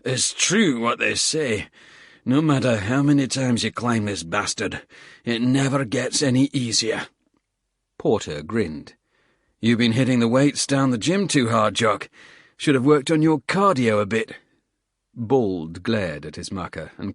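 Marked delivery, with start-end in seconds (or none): 0.0-7.1 a tired squaddie cursing the climb between breaths; lightly voiced Scottish soldier, puffing; Bald, winded Scottish soldier. Bald's winded Scottish grumble. 9.6-14.4 a hint of a gruff soldier ribbing his mate, louder; lightly voiced soldier, mocking grin; Porter, teasing.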